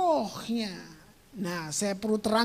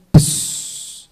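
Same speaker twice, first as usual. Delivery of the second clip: loud, sudden hissed puff; imitated breath entering; 10 dB louder than usual. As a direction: blow a forceful "pss" like air rushing in. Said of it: "Begitu nafas Allah masuk". (tiruan embusan napas yang kuat)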